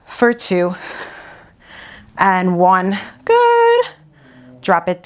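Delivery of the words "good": excited praise. Real, not acted. Instructor praising class mid-exercise.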